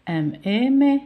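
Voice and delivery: teacher blending "M, E" into a held "me"; syllable sounding-out. Shown as a demonstration. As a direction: spell it, then land high on "me". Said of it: "ونقرأ في نفس الوقت".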